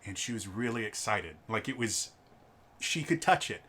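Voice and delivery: normal talking voice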